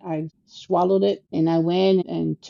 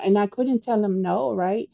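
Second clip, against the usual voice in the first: ordinary delivery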